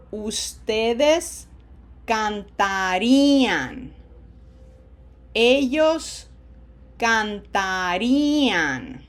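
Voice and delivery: Spanish conjugation drill with long held vowels; very slow modelling. Shown as a demonstration. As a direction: stretch every syllable so learners can imitate. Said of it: "that's why I go so slowly".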